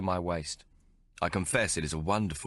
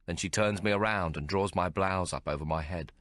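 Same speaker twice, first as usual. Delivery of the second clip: ordinary delivery, nothing marked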